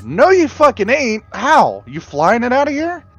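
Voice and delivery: high-pitched exasperated heckling; mock incredulity. Friend heckling the film's car thieves, high mock incredulity. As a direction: challenge them in squeaky disbelief. Mocking the thieves' absurd claim.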